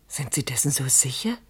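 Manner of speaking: hushed dread; anxious whisper, trailing into oh God; fearful whisper. Acted. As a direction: whisper it as doubt creeps in. Frightened hushed phone reply.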